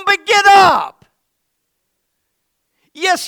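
fiery preacher shout; shouted preaching. Real, not acted. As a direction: shout them back on their feet. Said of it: "preaching at full force".

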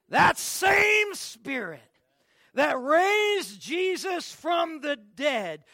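slow, loud, high preacher's cry; fiery preaching. Acted. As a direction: preach it at full force. Preaching at full force.

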